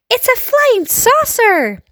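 high, drawn-out crowd cry; high-pitched shout from the crowd; excited shout. A narrator's reading. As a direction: shout it out in amazement, stretching the words. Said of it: "they shouted".

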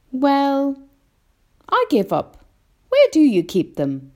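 put-on high, loud child, pitch falling; concede, then demand the answer; boy giving up. A narrator's reading. James gives up guessing, high boyish demand.

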